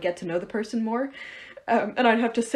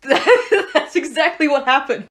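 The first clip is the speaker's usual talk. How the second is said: laughing; 5 semitones higher, 8 dB louder, much slower than usual. Real, not acted.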